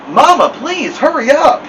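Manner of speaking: put-on high, urgent child's plea; urgent pleading. A narrator's reading. Boy begs Mama to come rescue his brother, urgent pleading. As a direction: beg her to move now.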